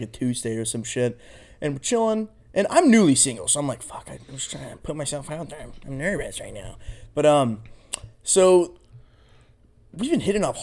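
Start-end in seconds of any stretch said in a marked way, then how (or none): 3.8-6.8 much softer, nervous self-quote; nervous mutter. Retold or imitated. Waiting for a date, nervously much softer under his breath.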